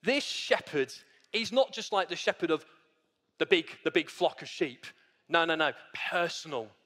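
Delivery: ordinary talk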